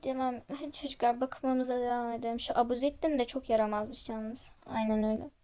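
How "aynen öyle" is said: ordinary delivery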